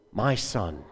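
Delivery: a father greeting his son gently; gentle greeting. Acted. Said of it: "How much more gentle".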